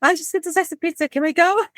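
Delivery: put-on high, hurried, nervous little-girl voice. Scared niece begging her uncle to leave, nervous. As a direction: rush it out, wanting to leave. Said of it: "I'm like".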